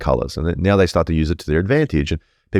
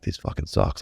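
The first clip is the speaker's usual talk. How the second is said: hushed whispered inner complaint; 2 semitones higher, 8 dB quieter than usual. Retold or imitated. Inner commentary about fake mates, whispered. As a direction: say it like a thought under your breath. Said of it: "running commentary inside my head".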